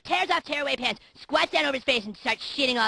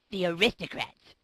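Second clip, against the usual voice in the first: deadpan punchline; 6 semitones lower, slower than usual. Retold or imitated. Punchline delivered by the father.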